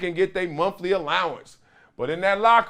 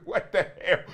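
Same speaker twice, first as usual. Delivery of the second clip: laughing disbelief; 4 dB quieter than usual. Retold or imitated. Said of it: "You're like".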